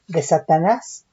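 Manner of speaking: usual speaking voice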